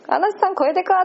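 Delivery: mock-amazed aside; amused astonishment. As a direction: blurt the thought high, half laughing. Voicing her amused thought in retelling.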